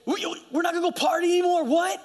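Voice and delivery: high-pitched mock disbelief; incredulous friends. Old friends questioning the new believer, mock incredulous. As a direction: imitate baffled friends, voice way up. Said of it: "All your friends".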